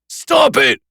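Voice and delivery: very high shrieked plea; yelled. A narrator's reading. Nick breaking under the taunts, yelling. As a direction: scream it to make it stop. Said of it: "he yelled".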